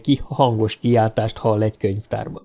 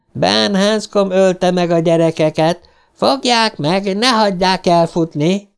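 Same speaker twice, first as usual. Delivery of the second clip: raised, higher accusing cry; accusing howl; 7 semitones higher than usual. A narrator's reading. Voice from the shelves accusing Ben, shouting. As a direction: cry out to the whole library. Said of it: "üvöltötte a hang".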